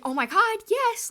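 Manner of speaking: hammed-up overjoyed yell at being asked out. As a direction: burst out yes.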